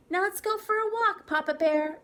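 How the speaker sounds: high, loud play-acting mother bear; Mama Bear voice. A narrator's reading. Tara as Mama Bear inviting Papa Bear walking, high and loud. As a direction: put on your best Mama Bear. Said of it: "in her best Mama Bear voice".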